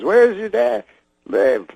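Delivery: high-pitched question dropping at the end; Bill Cosby impression. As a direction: ask it high, as a comedian's impression. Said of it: "He goes".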